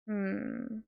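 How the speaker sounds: normal talking voice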